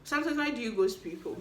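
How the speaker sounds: ordinary talk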